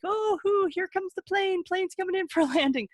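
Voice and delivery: put-on high, quick, playful parent feeding a baby; airplane spoon game. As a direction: zoom the spoon in like a cheerful parent.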